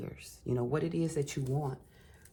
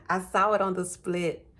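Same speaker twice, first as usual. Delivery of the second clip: louder, higher, thrilled to see it; excited; 5 semitones higher, 5 dB louder than usual. Real, not acted. Tarot reader spotting the card, excited and loud. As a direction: blurt it out with real excitement.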